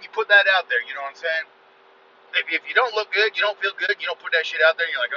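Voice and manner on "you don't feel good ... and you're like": ordinary talk, nothing marked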